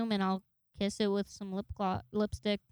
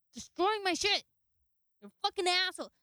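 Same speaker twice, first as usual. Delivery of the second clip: mock scolding; 6 semitones higher than usual. Owner scolding dog over shredding.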